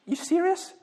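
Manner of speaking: a high, disbelieving "You serious?"; incredulous. Real, not acted. Preacher mocks toilet feng shui, incredulous. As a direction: scoff at it in open disbelief.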